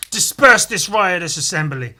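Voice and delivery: loud commanding lawman proclamation; stern judge order. Acted. Judge Dredd orders the partying Muppets to disperse, loud command. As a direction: bark the order at the crowd, loud and high. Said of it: "and he's saying".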